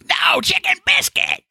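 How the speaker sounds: high, slow mock yell of a stubborn old man; old man shout. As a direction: grandpa cutting in at the drive-thru. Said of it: "He goes".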